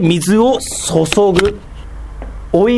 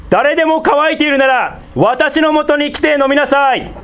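much higher, calling out to the crowd; loud proclamation; 11 semitones higher than usual. Acted. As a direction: cry it out like Jesus at the feast. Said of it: "わざと大声で言いましたが". (高い声で大きく呼びかける)

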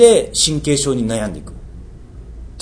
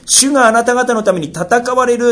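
raised high and loud, stress on ために; emphatic quote; 11 semitones higher, 4 dB louder than usual. Shown as a demonstration. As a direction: proclaim it, lifting ために. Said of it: "戦うって言ってるんですよ". (ためにを強調して高く)

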